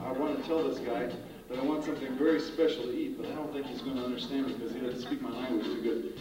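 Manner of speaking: usual speaking voice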